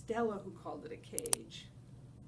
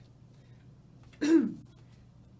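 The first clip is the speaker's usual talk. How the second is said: throat clearing; 6 semitones higher, 11 dB louder than usual. Real, not acted.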